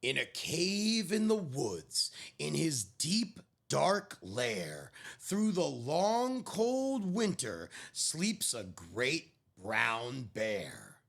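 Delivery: slow, hushed storybook reading; gentle picture-book narrator; story narration. A narrator's reading. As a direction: read it like a bedtime story. Picture book read aloud.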